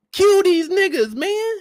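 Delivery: high, loud, drawn-out plea; yelling at a movie hero to kill; mock yell at the screen. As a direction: beg him to finish the fight. Yelling at Spider-Man on screen.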